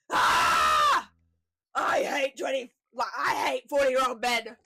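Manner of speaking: high yelled rant; hammed-up yelling chant; mock scream. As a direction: scream it like rowdy lads leaving the cinema. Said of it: "they were just screaming the whole way".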